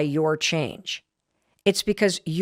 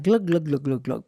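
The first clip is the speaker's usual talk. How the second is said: imitated pouring glug; 4 semitones lower than usual. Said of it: "I'm like".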